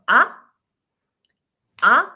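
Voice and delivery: AH rising sharply, like a surprised question; short loud AH sweeping upward; second tone, rising. Shown as a demonstration. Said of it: "rising your tone".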